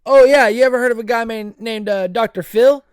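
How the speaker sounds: loud, high hype-man shout; hype announcer. Real, not acted. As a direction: pump up the crowd about the guest. Hyping upcoming guests, shouting.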